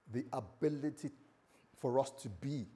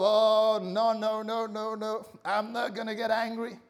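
loud, high, insistent refusal; hammed-up string of protests, holding temper back; acted strained self-control; 7 semitones higher, 7 dB louder than usual. Acting out straining against anger.

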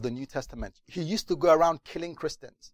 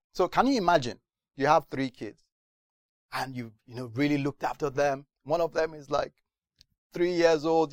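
normal talking voice

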